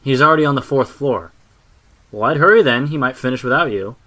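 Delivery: back-and-forth called across a distance; calling up. A narrator's reading. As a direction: shout it up to a window. Shouted exchange up to a window.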